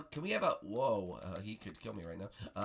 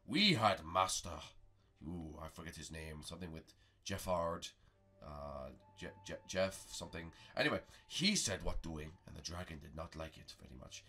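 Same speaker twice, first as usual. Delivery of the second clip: low, halting storyteller master; Master Prego; 4 semitones lower than usual. Pretend.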